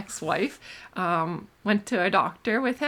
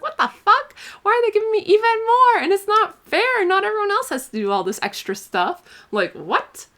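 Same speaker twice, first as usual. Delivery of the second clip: much higher, louder outraged protest; indignant child; 13 semitones higher, 6 dB louder than usual. Acted. Kid self protesting about extra homework, high indignant outburst. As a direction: squeal it like a kid who finds it unfair.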